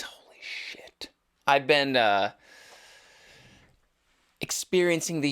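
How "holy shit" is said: slow, very soft whisper; hushed disbelief. Real, not acted. Host realizing the move is a week away, whispered disbelief. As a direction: drop to a whisper as it sinks in.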